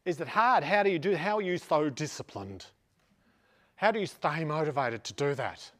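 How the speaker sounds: mock questioner voice. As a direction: imitate someone asking in a silly voice. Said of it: "I don't know why I'm doing that voice".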